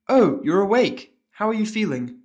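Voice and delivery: high, bright-eyed pony nurse calling out; cheerful surprise at a waking patient, slow and loud; excited exclamation. Acted. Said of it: "the nurse pony exclaims".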